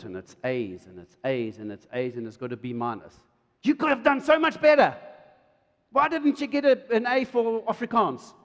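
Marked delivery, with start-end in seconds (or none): 3.6-8.3 high, loud scolding parent; critical parent. Retold or imitated. Father scolding child over report card, loud and high.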